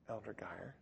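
very soft, low imitation of a gentle man; soft-spoken. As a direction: answer the screamer very quietly. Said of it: "Joe was just really soft-spoken".

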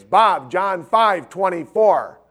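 slow, loud call across the lot; yelling back. Retold or imitated. Friend yelling a verse reference back to dad, slow loud call. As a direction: spell out the verse loudly so he hears. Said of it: "Dennis yells back at Bob".

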